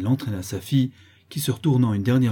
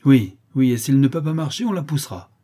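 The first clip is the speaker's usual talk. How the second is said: loud angry peasant; 4 semitones higher, 6 dB louder than usual. Acted. Crowd member shouts agreement.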